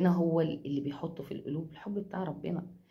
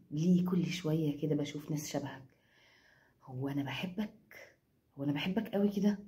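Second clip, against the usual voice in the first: imitating a disgusted sneer, second line quicker; mock disgust; 3 semitones lower, 6 dB quieter than usual. Quoting the runaway mocking her in disgust, mock sneer. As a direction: sneer it like the thought repels you. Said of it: "وبيقول لها بقرف كده".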